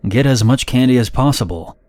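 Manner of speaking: plain reading voice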